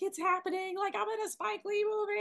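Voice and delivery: retold thrill, voice jumping up high; excited. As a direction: squeal it to the family on the phone. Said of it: "being so excited".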